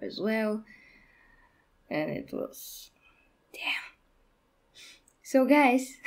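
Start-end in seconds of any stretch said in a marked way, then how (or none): 1.9-4.8 a breathless whisper; whispering, still moved. Real, not acted. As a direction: drop to a whisper, lost for words. Recalling crying at the movie.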